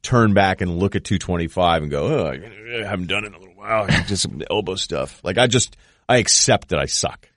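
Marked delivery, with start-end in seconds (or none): 2.1-5.2 lightly mocked old-school lifter, muttering softly; mock the excuse-making gym guy; hurt gym guy. Gym regular excusing himself from the bench, lightly mocked mumble. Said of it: "old school gym guy that tells you he's hurt".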